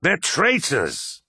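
"traitors" drawn out slowly and pitched high; stress on traitors. A narrator's reading. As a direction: lean hard and long on "traitors". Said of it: "needlessly emphasizing the last word".

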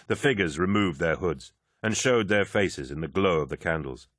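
ordinary delivery

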